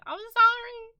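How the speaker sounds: very high, loud sorry; apologetic. Real, not acted. Apologizing to Shelly about the sub badge, high-pitched sorry.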